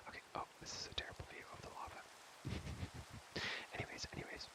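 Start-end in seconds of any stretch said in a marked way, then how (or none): 2.0-3.7 long hushed chuckle; whispered laugh. Real, not acted. Player mocking the lava view, whispered chuckle. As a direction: chuckle on the breath alone.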